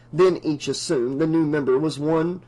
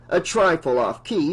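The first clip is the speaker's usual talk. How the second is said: ordinary delivery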